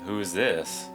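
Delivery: ordinary delivery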